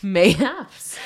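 loud, drawn-out "Mayhaps" with a rise; playful mayhaps. Acted. Mock Game of Thrones speech, loud and playful. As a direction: savour "Mayhaps" like a courtly word. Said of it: "start doing that".

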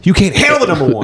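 high-pitched mock courtroom outburst; movie-quote outburst. Host parodying a film line for his co-host, mock outburst. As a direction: belt it out like a famous film line.

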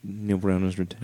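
soft, held-back announcement; too quiet to hear. Pretend. Host reads news his co-host claims not to hear, quietly. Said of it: "I can't hear you".

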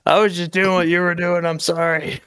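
high-pitched protesting apology; mock pleading kid. Acting the choked kid's apology.

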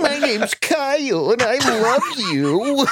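put-on lightly whiny kid brother voice, laughing; a hint of a whiny little brother; whiny little sibling. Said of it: "You went with the whiny little brother voice".

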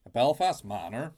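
loud, hammed-up Belfast accent. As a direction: belt out a Northern Irish twang. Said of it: "in a Belfasty manner".

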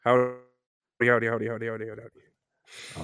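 lightly voiced Bob Levy, howdies fading; a light impression trailing off. Shown as a demonstration. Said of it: "That's pretty good".

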